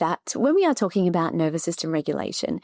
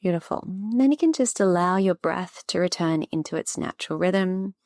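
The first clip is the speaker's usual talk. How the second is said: guided breathing. Acted. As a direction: ease the listener into calm, unhurried. Leads a short breathing practice.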